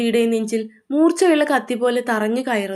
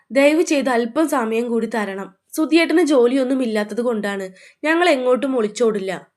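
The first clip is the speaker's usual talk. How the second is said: usual speaking voice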